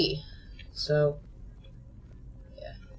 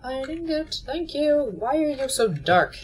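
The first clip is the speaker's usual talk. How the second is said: ordinary talk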